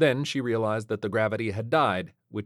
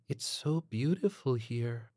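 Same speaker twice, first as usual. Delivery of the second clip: soft, slow, high wonder; blissed-out New Age waif; dreamy waif; 4 semitones higher, 6 dB quieter, slower than usual. A narrator's reading. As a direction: breathe it out dreamily, smiling. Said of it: "smiled dreamily".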